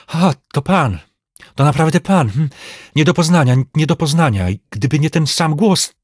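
high, agitated amazement; astonished recognition. A narrator's reading. Old servant recognising his changed master, astonished. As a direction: exclaim it in startled disbelief.

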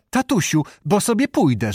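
put-on high-pitched, pleading girlish warning; lightly acted embarrassed daughter; daughter's protest. A narrator's reading. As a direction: protest to a teasing father. Daughter protests father's teasing.

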